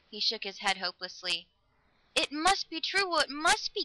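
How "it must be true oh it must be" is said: high, impassioned insistence; crying out. A narrator's reading. Girl insisting on a world after death, crying out. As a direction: cry it out, desperate to believe. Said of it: "Galatea cried".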